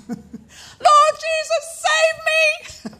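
slow, stretched shout for rescue; reliving a desperate cry for help; desperate yell. Retold or imitated. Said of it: "This Anglican girl yelled".

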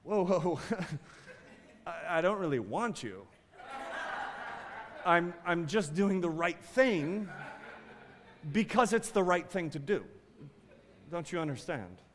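laughing through a deadpan refusal, soft; joking lines, laughter breaking in; mock cold suitor. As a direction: play the clueless fiancé, chuckling. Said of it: "if I were to respond and say".

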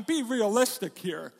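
usual speaking voice

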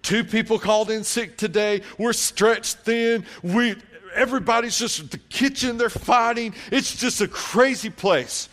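high, loud harried rant; acted-out exasperated waitress; frazzled waitress venting. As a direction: let the overworked server unload. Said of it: "And she went into it".

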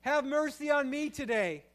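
loud, high, desperate pleading prayer; crying out. Pretend. Worshipper crying out to God for mercy, loud desperate plea. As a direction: beg God from the gut. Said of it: "wants to cry out".

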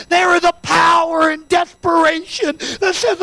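impassioned preacher, pitched high; full-force preaching. Real, not acted. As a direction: build to a forceful altar call, stretching key words.

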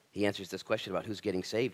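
ordinary delivery

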